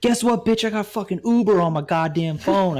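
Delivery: a hammed-up loud comeback; loud and cocky; mock loud retort.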